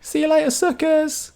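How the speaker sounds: mock them as you fly off; cheeky hamster voice. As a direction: hamster taunting the crew as she flies away. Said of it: "and goes".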